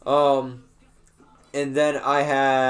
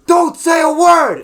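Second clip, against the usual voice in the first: slow, high, full-blown shout; principal's shout; 13 semitones higher, 10 dB louder, slower than usual. Retold or imitated. Principal turns round on the student, shouting. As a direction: bellow it with every word drawn out. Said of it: "he turns around and he goes".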